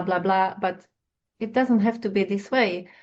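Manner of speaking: normal talking voice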